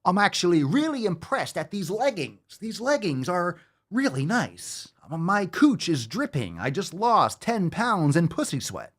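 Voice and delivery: high, hammy halting parody; William Shatner. Retold or imitated. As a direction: overact every phrase in a high mocking voice. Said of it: "She's like William Shatner".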